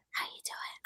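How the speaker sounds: voiceless aside; whispered chat. Real, not acted. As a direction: whisper a quick check-in. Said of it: "We're both whispering".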